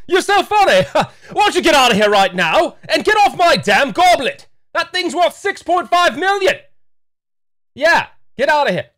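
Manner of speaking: loud, high British mock outrage; scornful British shouting with laughter; British accent. Nintendo throwing out the rep, loud scornful British accent. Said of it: "Nintendo has a British accent".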